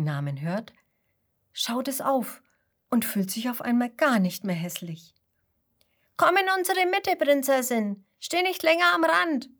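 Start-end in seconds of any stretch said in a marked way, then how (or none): 6.2-9.5 high, louder inviting call of the flock; sheep calling out. A narrator's reading. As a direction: call the lonely sheep into the middle. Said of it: "rufen die anderen Schafe". (hoher, lauter Ruf der Schafherde)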